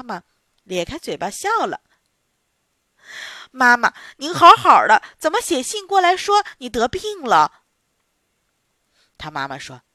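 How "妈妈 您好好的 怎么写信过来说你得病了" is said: surprised. Acted. Daughter surprised, mother is fine.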